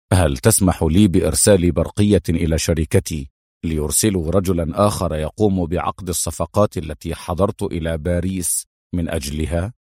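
low-pitched, measured request; polite detained hero. Acted. Adham asking the inspector to send a telegram, low and polite.

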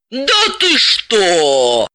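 very slow, drawn-out amazed exclamation; mock astonishment, teasing. Elizaveta teases Dalila, slow and amazed. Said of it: "поразилась Елизавета".

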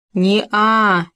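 mildly mimicking the other's 'а'; understated teasing echo; mocking mimicry. A narrator's reading. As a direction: parrot it back with a touch of scorn. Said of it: "передразнил его Хома".

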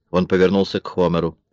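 ordinary delivery, nothing marked

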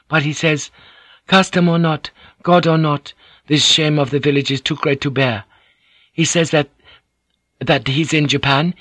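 high, measured woman's voice; Mariko, translating formally. Acted. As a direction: relay the message respectfully to your lord.

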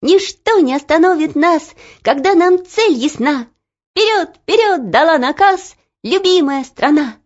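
high, spirited rhythmic verse; joyful marching song. A narrator's reading. Two girls starting their song together, joyful and spirited. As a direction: chant it brightly like a rallying song. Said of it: "девочки радостно запели".

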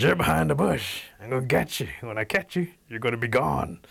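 threatening bear. Acted. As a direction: warn him you'll catch him.

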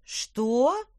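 loud startled what; sudden alarmed gasp; shocked. A narrator's reading. Horrified witch.

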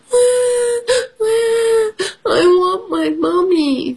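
very high wailing sob for mommy; baby mouse crying. Acted. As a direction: wail for mommy like a tiny lost mouse. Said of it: "cries Baby Mouse".

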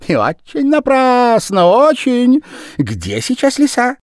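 very high, loud, rushed patter; fast-talking Japanese man. A narrator's reading. The Japanese man pattering at the gentleman, high and loud. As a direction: talk him round in a rush.

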